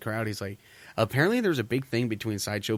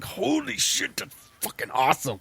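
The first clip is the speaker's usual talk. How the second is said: high squealing amazement; high excited squeal; awed delight; 10 semitones higher than usual. Retold or imitated. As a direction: squeal with wide-eyed glee. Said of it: "it's like".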